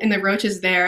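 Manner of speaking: ordinary talk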